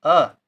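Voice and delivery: अ as a short, quick uh; short vowel. Shown as a demonstration. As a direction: say अ briefly and firmly. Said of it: "आ बहुत कम समय".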